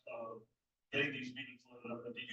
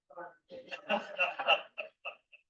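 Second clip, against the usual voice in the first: laugh; 3 semitones higher, 4 dB louder than usual. Real, not acted. Laughs after the trivia.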